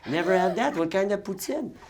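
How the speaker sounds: put-on clueless therapist in a French Canadian accent. Terrible therapist reacts to his patient, French Canadian accent. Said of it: "the therapist's like".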